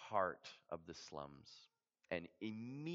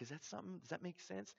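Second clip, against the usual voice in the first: higher but soft, tentative check-in; gentle question; 10 semitones higher, 7 dB quieter, faster than usual. Retold or imitated. Missionary checking on Clyde after the gospel, softly. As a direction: ask it gently, not pushing. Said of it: "I was like".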